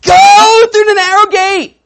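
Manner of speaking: high, loud, slow cheer; cheering shout. Retold or imitated. Pastor voicing the missing cheerers for the narrow gate, a high loud shout. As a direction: yell it like a fan in the stands.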